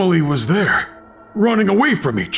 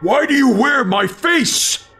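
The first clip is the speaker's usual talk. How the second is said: high, slow, insistent demand; drawn-out, high-pitched outraged question; slower than usual. Acted. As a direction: demand an answer, voice pitched right up. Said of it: "I demanded".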